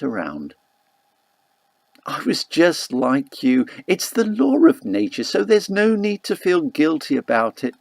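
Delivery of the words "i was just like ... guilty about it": mother's reassuring voice. Retold or imitated. Mother tells her guilty daughter it is nature's law, lightly voiced. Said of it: "she told me".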